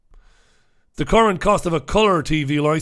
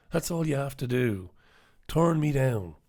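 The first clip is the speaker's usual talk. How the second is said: normal talking voice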